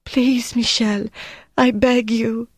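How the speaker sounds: a weak prisoner begs for mercy; begging. A narrator's reading. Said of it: "Gilberte said".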